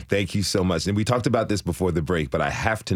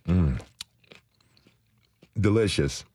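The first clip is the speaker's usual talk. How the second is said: talking with a mouthful; chewing a tomato while speaking; mouth full. Real, not acted. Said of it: "I'm sorry to talk with my mouth full of food".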